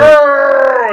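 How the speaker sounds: high, loud cartoon growl; imitated creature yell, high and loud; mock monster roar. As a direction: let out a goofy roar.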